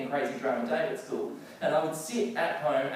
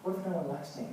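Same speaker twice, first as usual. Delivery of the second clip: little inner voice; 6 dB quieter, much faster than usual. Retold or imitated. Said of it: "a little voice in my head going".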